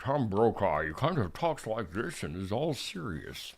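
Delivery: low, soft, serious newsman voice; deep grave anchorman delivery; Tom Brokaw impression. Host tries a Tom Brokaw voice for viewers, low serious news voice. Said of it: "never actually tried to do a Tom Brokaw".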